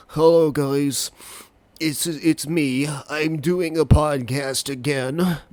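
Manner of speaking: slow, congested sick-voice greeting; sick yucky voice. Retold or imitated. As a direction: sound awful and stuffed up, slowly. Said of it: "where I sound awful".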